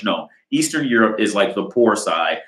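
ordinary talk

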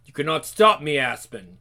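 usual speaking voice

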